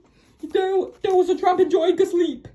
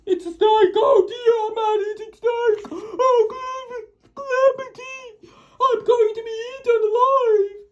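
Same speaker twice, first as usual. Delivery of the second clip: higher, rising panic; terrified wail; 4 semitones higher than usual. Acted. Scared man cries at the worm he thinks is a snake, high and panicky. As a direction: cry out that he'll be eaten.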